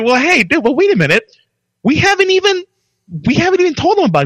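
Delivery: high, pushing and speeding up; excited protest. Retold or imitated. Protesting to the HR director, high rushed mock outrage. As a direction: plead your case fast, voice climbing. Retold protest to his boss.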